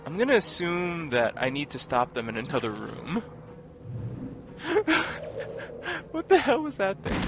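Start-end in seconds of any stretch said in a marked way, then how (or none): 3.3-6.1 high laugh after a scare; high, incredulous giggling; shaken laugh. Real, not acted. 6.1-7.2 high, loud, incredulous question; laugh-tinged cry of disbelief, pitched way up; real startled bafflement; bewildered. Real, not acted. Shaken after the fight.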